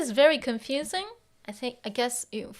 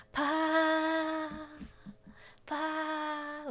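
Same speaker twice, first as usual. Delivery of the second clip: beaming pleased sound; 4 semitones higher, 5 dB quieter, slower than usual. Retold or imitated. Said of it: "it just looks like... she's shiny".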